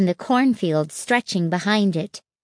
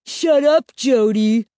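loud, higher-pitched irritated snap; snapping; 4 semitones higher, 5 dB louder than usual. A narrator's reading. Mark tells teasing Jody off, irritated snap. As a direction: snap it sharply at a teasing sibling. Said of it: "Mark snapped".

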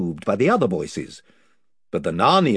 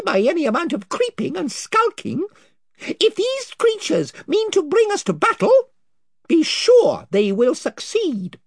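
high, proud little mouse knight; a brave tiny warrior addressing royalty, much higher; Reepicheep; 4 dB louder than usual. Acted.

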